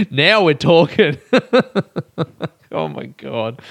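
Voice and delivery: slow, laughing through words. Real, not acted. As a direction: chuckle it out slowly. Laughing at own joke.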